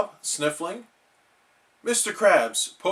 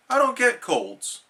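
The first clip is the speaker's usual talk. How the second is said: insisting; 10 semitones higher than usual. Acted. SpongeBob insists he's fine.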